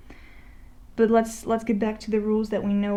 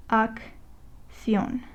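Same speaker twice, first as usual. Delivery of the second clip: "Reacción" in three separate syllables with pauses; 4 dB quieter than usual. Shown as a demonstration. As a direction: break "Reacción" up as Re, ac, ción. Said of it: "let's divide it".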